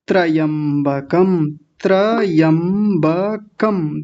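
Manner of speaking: very slow त्रयंबकम, split as tra-yam-ba-kam; broken into syllables. Shown as a demonstration. Teacher breaking the word up for the class, slow syllable-by-syllable त्रयंबकम. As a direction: draw out each piece of त्रयंबकम separately. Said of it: "मैं अलग तोड़ देता हूँ".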